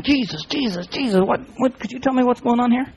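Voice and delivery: soft, urgent-sounding mock prayer; hushed prayer. Preacher acting Daniel talking to God, hushed prayer. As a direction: drop the volume and plead privately to God. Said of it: "he was talking to God".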